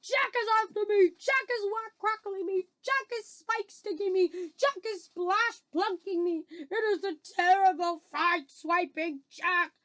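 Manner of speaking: high, loud, frantic wailing; terrified bellowing. Acted. Giant fleeing Jack in his nightmare, bellowing in terror. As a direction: bellow each line as a fresh alarm. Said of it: "bellowed the flesh lump eater".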